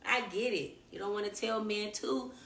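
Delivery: usual speaking voice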